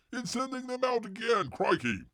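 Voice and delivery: high-pitched put-on Aussie accent; mock Australian outburst. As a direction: exclaim it like a hammed-up Australian. Mock Aussie outburst about resending orders.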